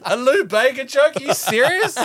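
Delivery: high laughing groan at a bad pun; laughing disbelief. Real, not acted. Groans at [name] joke.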